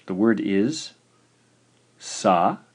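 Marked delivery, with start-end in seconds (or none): 2.0-2.6 sa, long pause, ke; syllable by syllable. Shown as a demonstration. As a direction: sa rising, then a separate ke. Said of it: "This one has two syllables".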